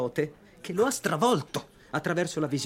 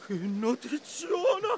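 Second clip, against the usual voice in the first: gleeful laughing; 10 semitones higher, much slower than usual. Acted. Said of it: "Guarda come gongola il mio gazzettiere".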